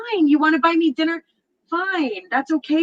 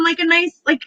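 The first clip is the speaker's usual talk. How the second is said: ordinary delivery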